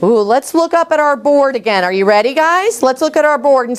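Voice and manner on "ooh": ordinary talk, nothing marked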